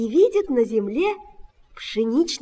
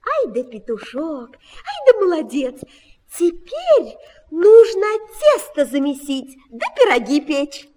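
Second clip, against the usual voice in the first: gushing praise; 4 semitones higher, 6 dB louder than usual. A narrator's reading. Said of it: "не нахвалятся".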